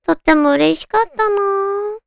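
usual speaking voice

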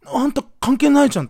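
loud, high-pitched protest acted out; exasperated inner comeback; indignant retort. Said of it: "と思ったんだけど".

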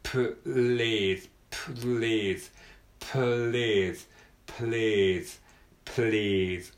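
"please" with the p-l held slowly. Shown as a demonstration. Teacher showing a slow please for beginners. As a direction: take please slowly, cluster first. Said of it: "最初はゆっくりでもいいので".

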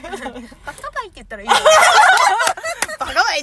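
loud cutesy バカバイ with laughing; cute dialect. Retold or imitated. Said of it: "バカバイって言ってもダメだ". (可愛い子ぶって)

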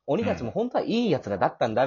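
ordinary talk

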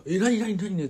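high, loud burst of confusion; startled awake. Retold or imitated. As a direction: re-enact jolting awake in alarm. Startled awake by neighbour's scream.

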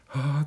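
high loud aghast gasp; dismayed gasp. Retold or imitated. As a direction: gasp in horror. Horrified gasp.

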